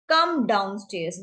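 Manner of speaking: drawn-out teaching delivery; slow demo phrase; slow model phrase. Shown as a demonstration. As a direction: stretch it so learners can follow. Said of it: "teacher models English phrase".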